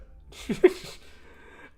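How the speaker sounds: brief, airy chuckle; short laugh. Real, not acted. Editor fiddling with settings, short amused laugh.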